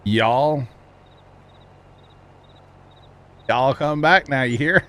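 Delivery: Y'all come back now, ham up the Missouri twang. Shown as a demonstration. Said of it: "Missouri redneck is really what I speak".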